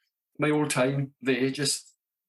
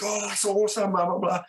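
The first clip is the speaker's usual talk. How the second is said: mock excitement; 8 semitones higher than usual. Friend reacts to photo.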